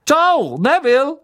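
high, loud cheery shout; called-out goodbye. A narrator's reading. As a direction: holler a farewell across the room. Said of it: "rief Stan".

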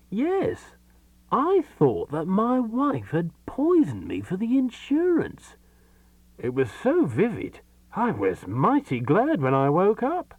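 high and slow; agitated. Acted. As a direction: recount it still shaken. Man recounts horrid dream.

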